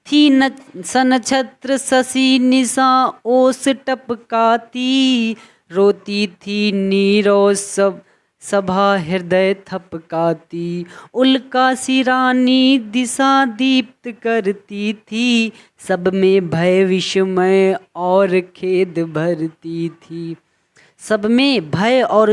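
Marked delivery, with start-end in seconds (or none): 0.1-10.8 slow, drawn-out poetic recital; poem recitation. Acted. Teacher recites the poem to the class, slow recital. 11.1-14.9 slow, drawn-out recital, a little higher; poem recitation. Acted. Teacher recites the verse before explaining it, slow recital. 14.9-20.3 slow, drawn-out poetic recital; poem recitation. Acted. Teacher recites the poem to the class, slow recital.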